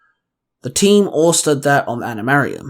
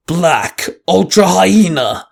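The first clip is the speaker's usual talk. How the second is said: slow, louder battle call; 3 semitones higher, 4 dB louder, much slower than usual. A narrator's reading. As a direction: shout the morph call, drawn out.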